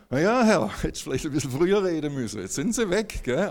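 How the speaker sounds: a cheeky jab at the Lord; wry complaint. Retold or imitated. To God after the couple drove off, wry teasing.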